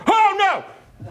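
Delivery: high, squeaky cry of alarm; hammed-up fright; mock panic. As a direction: yelp like a cornered sheep. Sheep sees shepherd coming.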